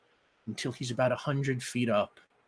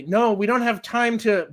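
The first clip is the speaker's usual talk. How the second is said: cut-off protest; 10 semitones higher, 10 dB louder than usual. Acted. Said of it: "he starts to say".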